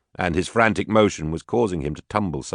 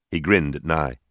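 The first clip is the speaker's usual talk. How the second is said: ordinary delivery, nothing marked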